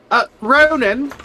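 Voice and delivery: calling out. Acted. Calling out to the barkeep.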